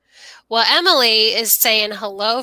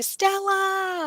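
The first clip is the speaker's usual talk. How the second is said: 1 chat cheer; 7 semitones higher, 6 dB quieter than usual. Retold or imitated. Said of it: "Everybody in the chat is saying".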